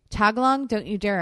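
firm warning at a naughty pet; scolding the dog. Real, not acted.

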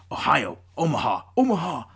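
whispered American line-of-scrimmage calls; American quarterback accent. Retold or imitated. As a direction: call the play like an NFL quarterback. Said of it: "pretty good American accent".

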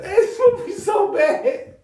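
slow, high, laughing yell; laughing shout. Real, not acted. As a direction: cry it out through laughter. Laughing at a bad movie.

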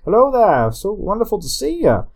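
high, loud Irish leprechaun greeting; lilting leprechaun accent, pitched well up. Acted. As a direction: put on a chirpy leprechaun brogue. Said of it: "they're speaking like a leprechaun".

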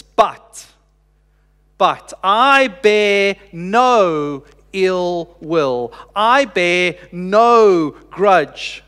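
a grieving father refusing hatred, deliberate and steady; the preacher voicing a forgiving father, weighted and very slow; slow solemn forgiveness.

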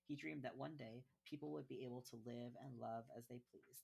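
soft, even storybook reading; reading aloud. Acted. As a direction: read it gently to a child. Reads the picture book aloud.

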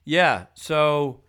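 lightly voiced pushy caller; voicemail guy. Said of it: "this guy's voice".

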